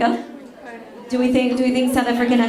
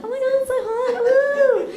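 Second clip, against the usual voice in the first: very high squealed swoon; hammed-up high-pitched thrill; mock swooning; 14 semitones higher than usual. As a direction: squeal as if melting over him. Acted swooning at Italian.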